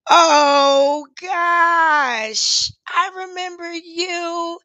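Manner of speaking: fighting not to cry; close to tears. Real, not acted. Recognizing her old friend, slow and near tears. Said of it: "I'm gonna try not to cry".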